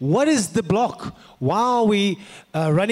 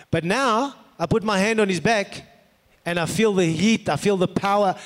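ordinary talk, nothing marked

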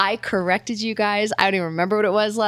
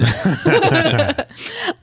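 high bubbly giggle; squeaky gleeful giggle; 8 semitones higher, 5 dB louder than usual. Real, not acted. Laughing after the voices remark.

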